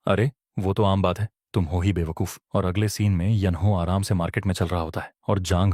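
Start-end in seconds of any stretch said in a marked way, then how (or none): none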